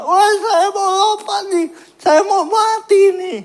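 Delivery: very high, wailing frantic yell; shouting like a madman. Retold or imitated. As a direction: scream it out like someone gone mad. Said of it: "Kayak orang gila". (berteriak histeris seperti orang gila)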